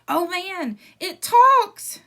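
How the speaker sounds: high mocking shriek; mocking scream. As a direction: shriek it out in mockery. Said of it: "screamed Eddie".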